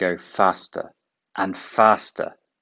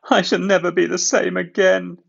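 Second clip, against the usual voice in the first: sad; 11 semitones higher, 8 dB louder than usual. A narrator's reading. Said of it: "he thought sadly".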